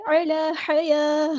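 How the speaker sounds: اعلی حیا with a pause at the first stop; slow chant stopping at the first waqf; tajweed recitation. Shown as a demonstration. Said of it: "آپ کس طرح پڑھیں گے؟".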